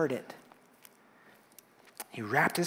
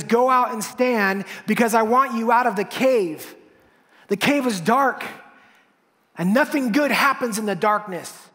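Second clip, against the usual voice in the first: louder, higher sermon climax; full-force preaching; 7 semitones higher, 8 dB louder than usual. Acted. Preacher rousing the congregation, full force. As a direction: drive it home to the congregation.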